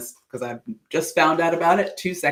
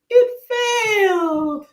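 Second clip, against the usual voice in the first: high, loud, drawn-out cry of dismay; mock wail; 5 dB louder, much slower than usual. Real, not acted. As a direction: wail it out, stretching the word. Mock lament over failed download.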